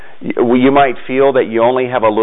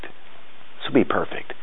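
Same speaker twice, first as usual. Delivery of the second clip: whispered; 2 semitones lower, 10 dB quieter, slower than usual. Retold or imitated. Jesus over the small loaves, whispering slowly. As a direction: whisper it, slow and tender.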